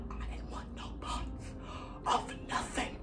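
hushed whisper while hiding. Real, not acted. Hiding behind the bathroom door, whispering.

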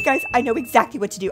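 normal talking voice

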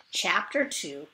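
usual speaking voice

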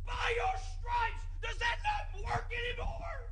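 very high, slow, strained shouting at God; anguished shouting. Retold or imitated. Preacher screaming at God over the dead baby, high slow anguished shouting. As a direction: scream the question at heaven, slowing in anguish. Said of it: "and I was yelling".

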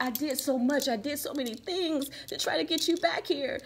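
high-pitched imitation of a tearful ex; mock crying plea. Reader voicing the crying ex who tried everything, mock tearful plea. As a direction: plead it up high, as if crying over lost efforts. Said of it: "But they're crying. They can't, they're like".

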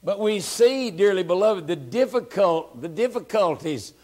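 high, loud, rhythmic preaching; preaching at full force. Acted. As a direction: stir them up, dearly beloved.

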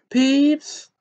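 very high, loud furious call; angry yell. A narrator's reading. Professor calling out a troublemaking poltergeist, angry yell. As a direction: yell the name in outrage. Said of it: "yelled an angry voice".

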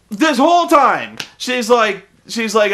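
ordinary talk

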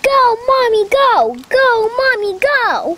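high sing-out cheer; excited, high-pitched urging. Real, not acted. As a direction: cheer Mommy on eagerly. Children cheer mother into water.

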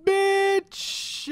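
loud, high, drawn-out holler; shouted wake-up intro. Pretend. Host greeting viewers with a wake-up call, shouted. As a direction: belt out a brash wake-up call.

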